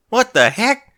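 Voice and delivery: loud high shocked yell; startled yell. Acted. Applejack yelling at the sudden extra hooves, startled. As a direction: jolt out a yell of surprise. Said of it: "Applejack yelled".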